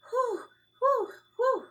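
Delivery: high, loud panting sighs of relief; relieved whew. Acted. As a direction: blow out relief after a close call. Hamster relieved after danger.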